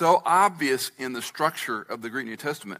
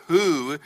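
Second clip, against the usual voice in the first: "Who" drawn out and louder; stress on Who; 4 semitones higher, 7 dB louder than usual. Shown as a demonstration. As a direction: lean long and hard on "Who". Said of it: "I'll emphasize the words".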